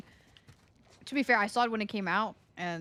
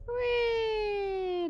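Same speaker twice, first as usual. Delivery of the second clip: gleeful squeal; 14 semitones higher than usual. Real, not acted. Gleeful cry while jumping.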